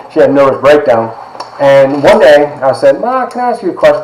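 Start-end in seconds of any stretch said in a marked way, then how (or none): 3.0-4.0 put-on high, timid child's voice asking mom; small child asking. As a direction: ask like a little boy looking up at her.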